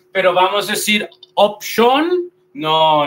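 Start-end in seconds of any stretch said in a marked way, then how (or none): 1.3-2.3 "option" as "op-SHON", both O's full; wrong Spanish-style pronunciation. Shown as a demonstration. Teacher tests learners with the mistake, mispronounced. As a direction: end "option" on a rising, heavy "shon". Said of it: "no vamos a decir option".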